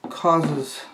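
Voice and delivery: ordinary talk, nothing marked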